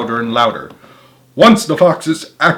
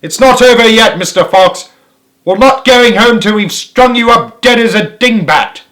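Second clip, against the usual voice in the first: higher, louder threat; vengeful yell; 9 semitones higher, 8 dB louder than usual. A narrator's reading. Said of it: "yelled Bean".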